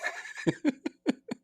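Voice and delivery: high-pitched burst of laughter; real laugh. Real, not acted. Amused at Rogan's episode just ending, high laugh.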